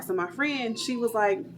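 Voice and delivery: ordinary talk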